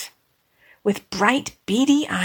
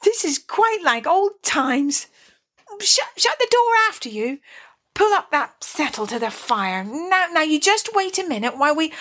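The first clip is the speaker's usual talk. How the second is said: higher-pitched, bustling eager host; 7 semitones higher than usual. Acted. Mole welcomes the field mice to the fire, eager. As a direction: fuss over the guests eagerly, tripping over words. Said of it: "cried the Mole eagerly".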